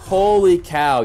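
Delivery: normal talking voice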